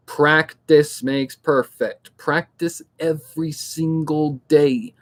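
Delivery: "Practice makes perfect. Practice every single day." slowly, word by word; slow emphasis. Real, not acted. Last advice to aspiring narrators, slow and emphatic. Coach drives home key advice.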